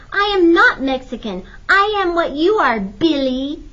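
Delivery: loud, high-pitched indignant protest; angry cry. A narrator's reading. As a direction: fling it back as an angry little girl. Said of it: "Lupe cried angrily".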